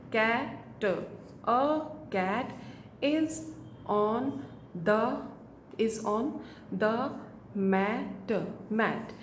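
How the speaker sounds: each word said apart with pauses; halting word by word beginner read. Shown as a demonstration. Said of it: "इस तरह से बच्चा book reading करेगा".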